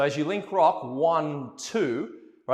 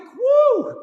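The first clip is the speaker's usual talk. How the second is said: high, loud whoop; excited whoop; 10 dB louder than usual. Real, not acted. Teacher cheering the spin count, excited whoop. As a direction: whoop as the lady spins.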